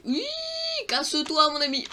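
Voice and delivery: loud, high shout; shouting someone off. Real, not acted. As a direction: yell at them to get lost. Shooing off a player in game. (cri aigu pour le chasser)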